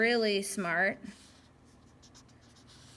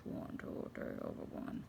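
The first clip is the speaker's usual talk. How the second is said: hushed, voiceless counting, much softer; graphing voice; 13 dB quieter than usual. Shown as a demonstration. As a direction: breathe the count out like a secret. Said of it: "That's my graphing voice".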